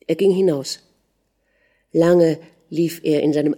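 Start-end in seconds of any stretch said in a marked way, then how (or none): none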